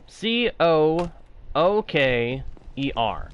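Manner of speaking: normal talking voice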